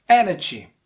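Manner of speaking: ENERGY with a loud, strong EN, the rest light; EN-ergy, punch the start of energy; first-syllable stress. Shown as a demonstration. Teacher setting the quiz word, stressed on EN. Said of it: "stress falls on the first syllable".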